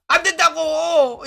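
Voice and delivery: high, drawn-out mock protest. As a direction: stretch the refusal out slowly, pitched high. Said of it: "미코도 니코피 이야기가 나오자마자". (높고 길게 끄는 항의 흉내)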